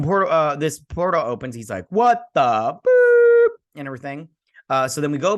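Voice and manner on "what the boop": much higher, much louder, drawn out very slowly; a big goofy cartoon yelp of alarm; startled exclamation. Retelling his reaction as the portal opens, a drawn-out, goofy startled yelp.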